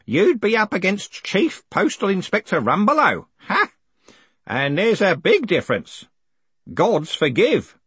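put-on high-pitched old zealot; old postal worker, scornful. Grote warns his boss about the postal inspector, high and scornful. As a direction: warn in a high, emphatic old man's voice.

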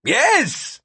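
high-pitched yell in reply; shouted back. A narrator's reading. Gilbert answering Vikram across the crowd, shouted back. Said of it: "Gilbert shouted back".

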